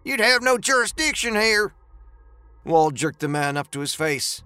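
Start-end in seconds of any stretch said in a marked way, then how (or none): 0.0-1.7 high, insistent objection; high-voiced indignant man; protesting Dooley character. A narrator's reading. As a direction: argue back in a high voice. Threatened Dooley protests in story.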